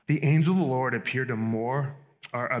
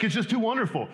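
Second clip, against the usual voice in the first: higher, light mock exasperation on too wonderful; mock awed exasperation; 8 semitones higher than usual. Preacher mimicking the frustrated psalmist, mock exasperated. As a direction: throw up your hands, half laughing at it. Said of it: "It's like".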